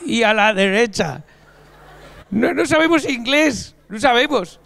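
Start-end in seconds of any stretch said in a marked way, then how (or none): 0.0-1.2 put-on Spanish in a slow English accent. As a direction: give directions in a mock English accent. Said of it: "te sale un perfecto acento inglés".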